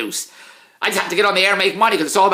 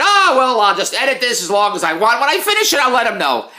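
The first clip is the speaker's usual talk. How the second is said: mock editor; 5 semitones higher, 4 dB louder than usual. Mocking the editor's thinking.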